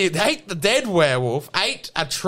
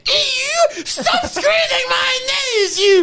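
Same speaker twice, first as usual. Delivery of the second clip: much higher, shouted werewolf in a hammed-up French Canadian accent; 13 semitones higher than usual. The werewolf fights off Catherine, shrieking high in a hammed-up French Canadian voice. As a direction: yelp and rage like a cornered beast. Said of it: "French Canadian werewolf".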